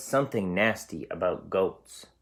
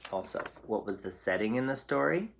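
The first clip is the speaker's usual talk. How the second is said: usual speaking voice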